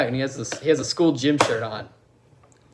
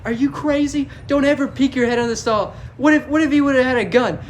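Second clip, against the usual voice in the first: high, loud retold telling-off; mock scolding; 8 semitones higher, 4 dB louder than usual. Principal scolding a kid, going off. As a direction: go off on them, pitched up and urgent. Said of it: "she fucking goes off on this kid".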